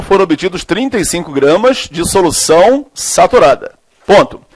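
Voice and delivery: slow, measured dictation with long pauses; classroom dictation. Real, not acted. Teacher dictating a chemistry question to students, slow dictation. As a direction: dictate phrase by phrase, stretching the words.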